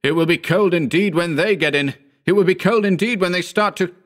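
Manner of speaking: high, agitated outburst; shouted. A narrator's reading. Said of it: "I shouted".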